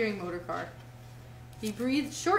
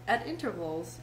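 usual speaking voice

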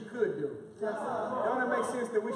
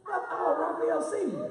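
Mexican accent; 7 semitones higher, 3 dB quieter, slower than usual. Real, not acted. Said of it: "She got me talking like I got a his Mexican accent".

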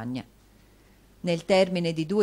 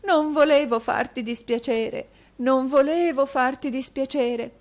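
pleading; 7 semitones higher, 5 dB louder than usual. A narrator's reading. Wife pleading with distraught husband.

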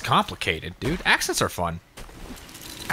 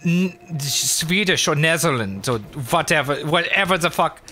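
higher, cartoonish German-Swedish accent; quote-unquote German accent; 5 semitones higher than usual. Shown as a demonstration. Demonstrating that no German sounds like this, mock German accent. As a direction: exaggerate a fake German accent, pitched up. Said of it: "Nobody in Germany sounds like this".

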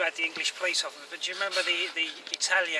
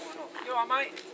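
doggy talk; 9 semitones higher than usual. Real, not acted. Greeting the dog.

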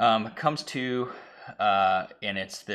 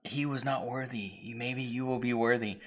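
lightly voiced eerie pronouncement; creepy cult voice; 3 semitones higher than usual. Sewer dwellers judging the lady, lightly eerie voice. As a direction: proclaim it like a solemn cultist. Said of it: "they're like".

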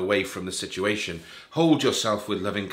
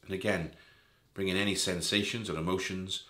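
softer, lower meditation voice with long pauses; guided meditation; 3 semitones lower, 4 dB quieter than usual. Real, not acted. Guide leading listeners through a body scan, calm guided meditation. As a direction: ease the listener gently through each part of the body, settling softer as it goes.

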